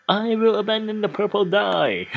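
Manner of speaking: said through a laugh; amused, chuckling as he decides. Real, not acted. Streamer choosing inventory item, laughing at himself. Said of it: "this is very fun voice acting".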